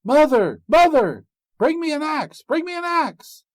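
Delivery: very high, loud desperate call. A narrator's reading. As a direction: yell for help while fleeing. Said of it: "So he called out".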